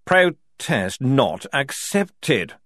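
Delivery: loud, slow, emphatic refusal; snapped. A narrator's reading. General overruling Strappy, snapping. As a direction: slap the objection down word by word. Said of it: "Frock snapped".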